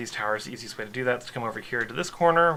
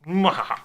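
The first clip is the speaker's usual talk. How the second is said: mad scientist; 6 semitones higher, 9 dB louder than usual. Pretend. Jokey evil laugh after pillaring.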